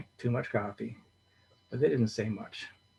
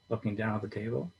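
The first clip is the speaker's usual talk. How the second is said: usual speaking voice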